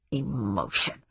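low, quiet grumble; muttered. A narrator's reading. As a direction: mutter it disdainfully to yourself. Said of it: "muttered Speidel".

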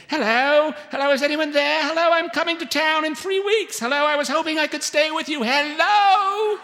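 high, loud British woman hollering down the phone; very high British voice calling over a bad line; thick British accent. A narrator's reading. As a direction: shout it in a shrieky, high British voice. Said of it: "a voice with a thick British accent".

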